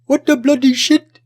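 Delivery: put-on Indian accent, high and loud, rising at the end; imitating a friend's Indian accent. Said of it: "with the, the Indian accent".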